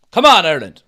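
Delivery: loud cheer for the team. Real, not acted. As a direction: shout for the team. Cheering at the match.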